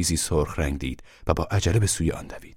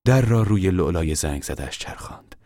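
plain reading voice

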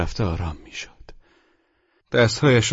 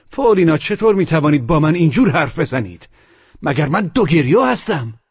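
high, loud, indignant protest; reproachful shout; 7 semitones higher, 4 dB louder than usual. A narrator's reading. Narrator protests being likened to De Grieux, shouting reproachfully. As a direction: cry out in wounded reproach. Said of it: "به فریادی سرزنش بار گفتم".

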